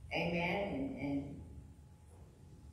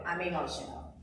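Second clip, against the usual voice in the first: loud, rising preacher's cry to the ladies; impassioned preaching; 6 semitones higher, 8 dB louder than usual. Acted. Preacher to the women, loud and emotional. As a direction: preach it, pushing louder and higher.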